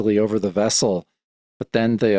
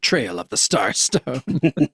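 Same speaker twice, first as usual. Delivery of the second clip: slow, drawn-out dramatic read; slow, relishing title read; savoring the title; much slower than usual. Shown as a demonstration. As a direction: linger lovingly on Starstone. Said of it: "I love the title".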